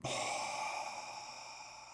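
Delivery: slow audible exhale; relaxing sigh; long release sigh. Acted. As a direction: let the breath fall out. Releasing held breath.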